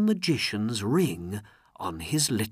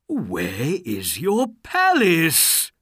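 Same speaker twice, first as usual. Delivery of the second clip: slow, loud, high furious question; drawn-out, angry cry; angry demand; 7 semitones higher, 6 dB louder, slower than usual. A narrator's reading. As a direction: demand it as an enraged ruler. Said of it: "he cried angrily".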